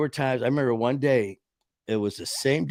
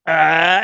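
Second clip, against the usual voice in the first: mock laugh burst; 5 semitones higher, 8 dB louder than usual. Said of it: "he just went".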